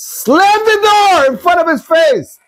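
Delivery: high, indignant miserly husband yelling at his wife; high-pitched scornful rant about giving nothing; stingy husband. Retold or imitated. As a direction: sneer it out like an outraged cheapskate. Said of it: "The husband says".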